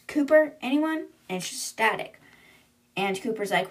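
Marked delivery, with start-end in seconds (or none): 0.1-1.1 high, questioning call into the radio; retold yell. A narrator's reading. Anna calls for the crew after the attack, mild yell. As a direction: call out, voice lifting, not full volume. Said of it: "And then Anna yells".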